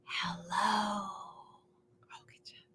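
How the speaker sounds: barely audible whispered hello; tiny hushed hello; as quiet as we can. Shown as a demonstration. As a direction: breathe the hello almost silently. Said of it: "Let's say hello as quiet as we can".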